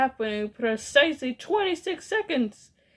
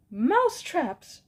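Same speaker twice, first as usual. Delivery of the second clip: high, swooping exclamation; witches' cry; 7 semitones higher than usual. A narrator's reading. Witches echoing their leader, high excited cry. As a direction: echo it back in a high cry. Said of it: "cried the witches".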